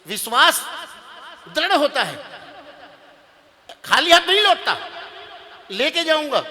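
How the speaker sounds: high, ringing, loud resolve; insistent declaration. Acted. Groom refusing to leave empty-handed, loudly insistent. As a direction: declare it with total conviction.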